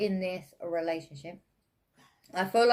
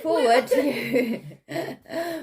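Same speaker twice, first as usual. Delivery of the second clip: words breaking up with laughter; laughing; 7 semitones higher, 9 dB louder, slower than usual. Real, not acted. Reader cracking up at spirits' shush, laughing. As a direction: laugh through the line.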